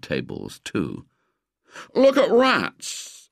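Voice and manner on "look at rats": high-pitched, drawn-out schoolboy exclamation; orating like an earnest schoolboy; eloquent boy. A narrator's reading. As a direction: declare it slowly, pitched well up. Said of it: "went on William eloquently".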